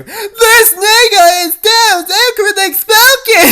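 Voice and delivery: very high, loud squealing exclamation; shocked onlooker. Acted. A random cafe customer gasping at Niall, squealed very high and loud. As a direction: shriek it like a stunned bystander.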